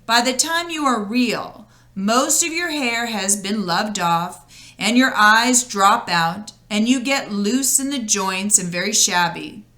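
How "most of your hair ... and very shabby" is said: plain reading voice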